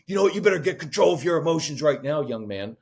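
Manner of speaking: hammed-up stern parent snapping at a son; mild mock scolding, rushed; mock yelling parent. Shown as a demonstration. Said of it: "'cause screaming and yelling isn't effective".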